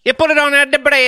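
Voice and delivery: loud, very high cartoon Italian; Italian accent. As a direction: shout it as a caricature Italian. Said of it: "do an Italian accent".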